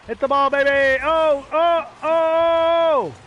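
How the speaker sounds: high, loud yelling at the screen; excited shouting. Real, not acted. Gamer screaming at the match, hyped. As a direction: cheer the play on at full voice. Intense moment in game.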